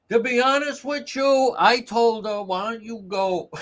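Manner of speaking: high mocking voice, held up; mocking imitation. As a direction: mimic it high and sing-song.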